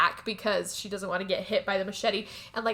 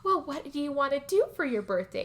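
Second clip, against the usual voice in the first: high sugary voice of fake delight; sweet fake interest; 6 semitones higher, 3 dB quieter than usual. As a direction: gush like a sly old woman. Rose feigning interest.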